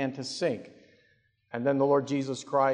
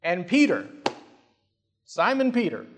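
ordinary talk

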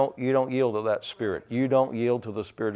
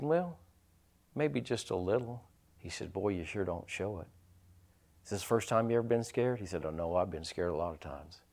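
much softer, lower storytelling voice; hushed retelling; 5 semitones lower, 12 dB quieter than usual. Retold or imitated. Father tells son of fear, very soft. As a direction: drop to a near murmur.

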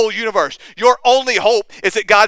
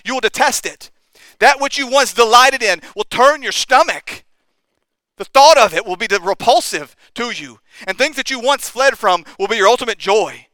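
rising, louder sermon build; preaching at full force; 4 semitones higher, 3 dB louder than usual. Acted. Preacher urging the church that their affections will change, fervent. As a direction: drive it home to the congregation.

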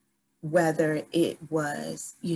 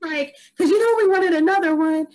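laughing; 12 semitones higher, 7 dB louder than usual. Retold or imitated. And she cracks up laughing.

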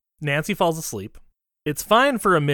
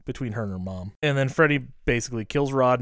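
ordinary talk

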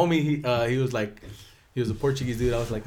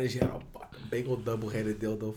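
hushed unvoiced reveal; whispered; 2 semitones lower, 8 dB quieter than usual. Real, not acted. Co-host revealing grandma's secret, whispered. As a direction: whisper the scandalous part.

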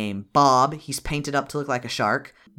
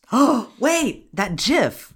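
sudden recognition; 9 semitones higher, 4 dB louder, much slower than usual. Real, not acted.

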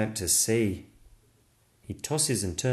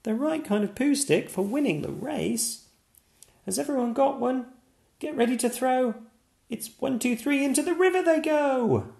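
put-on much higher, eager girl's voice; rally everyone for the race; excited little girl.

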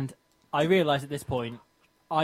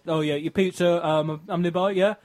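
louder, higher imitation of a delivery driver; pizza delivery guy; 4 semitones higher, 5 dB louder than usual. Pizza guy calling the customer, imitated driver voice. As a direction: mimic a driver checking in by phone. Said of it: "He then said".

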